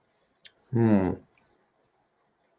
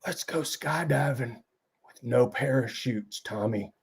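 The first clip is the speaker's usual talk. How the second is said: character dialogue; 3 semitones higher, 11 dB quieter than usual. Pretend. Sean proposing skydiving to Tommy, soft character voice. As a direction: play an old hero quietly pitching a plan. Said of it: "how a single person does dialogue".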